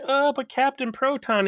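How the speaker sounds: high pleading voice; pleading whine. Retold or imitated. Harry pleads with Tom.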